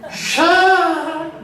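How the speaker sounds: very slow high drawn-out hiss-like "shaak"; spirit's exit sound. Retold or imitated. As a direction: let the spirit's cry stretch out high and loud. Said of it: "소리를 내더니".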